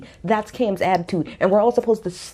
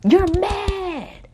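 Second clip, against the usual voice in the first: drawn-out MAD, pitched way up high; high-pitched taunt; 10 semitones higher than usual. Real, not acted. Taunting a castmate over her printed tweets, high-pitched jab. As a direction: sing out the taunt high and long. Taunting Cam, repeated much higher.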